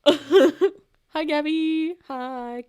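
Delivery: gasp, then squeal the name loudly; high, loud, laughing hello to a friend; delighted greeting. Real, not acted. Spots a friend joining, greets them.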